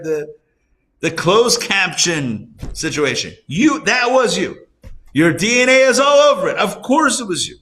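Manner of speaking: over-the-top incredulous scolding of the excuse-maker; mock exasperated rebuttal. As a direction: call them out in disbelief, hammed up.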